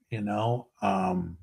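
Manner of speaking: normal talking voice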